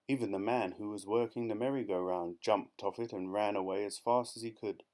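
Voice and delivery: ordinary delivery, nothing marked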